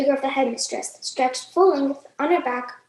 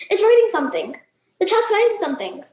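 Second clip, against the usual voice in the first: higher, louder excited yell; alarmed shout; 7 semitones higher, 4 dB louder than usual. Acted. Pupils shout to the room as the chalk writes, excited yell. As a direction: cry out as the chalk moves.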